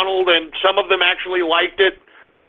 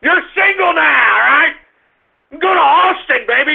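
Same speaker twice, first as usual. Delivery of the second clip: high, whooping party cheer; playful holler; 6 semitones higher, 3 dB louder than usual. Pretend. Hollering party advice to Donnie.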